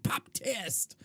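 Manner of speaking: lightly imitated bulky accent on "Pop test"; bulky foreign accent. Professor springing a test on students, in a lightly mocked bulky accent. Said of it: "in this, like, bulky accent".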